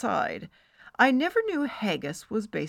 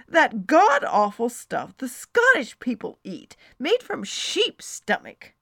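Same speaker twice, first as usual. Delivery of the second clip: loud, revolted emphasis; emphatic disgust; 4 semitones higher, 7 dB louder than usual. Acted. Friend describes the awful Scottish dish, emphatically disgusted. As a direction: punch each awful detail. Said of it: "she said emphatically".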